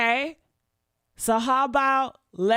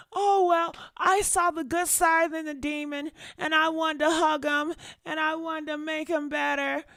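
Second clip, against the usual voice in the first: higher, lightly voiced naive girlfriend; mock the gullible excuse, a touch higher; mocking naive woman; 7 semitones higher than usual. Mocking a woman defending abuser.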